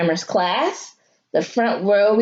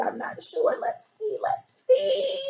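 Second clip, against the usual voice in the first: high, soft whispered worry; nervous whisper; 7 dB quieter than usual. A narrator's reading. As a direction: hush it like you can't bear to look.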